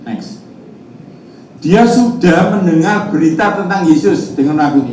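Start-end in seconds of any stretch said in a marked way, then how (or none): 1.6-4.3 loud, high verse proclamation; scripture reading aloud. Real, not acted. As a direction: declare the verse to the congregation. Pengkhotbah membacakan ayat Alkitab. (membaca ayat dengan lantang dan bernada tinggi)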